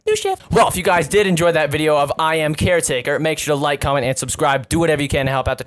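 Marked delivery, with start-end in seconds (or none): 0.0-0.5 mock game voice. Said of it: "they was like".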